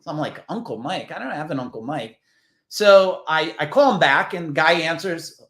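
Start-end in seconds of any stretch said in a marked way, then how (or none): 0.5-2.2 softer, muttered to oneself; retold puzzlement. Man puzzling over an unknown uncle's message, retold puzzlement. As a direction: play mild confusion under the breath.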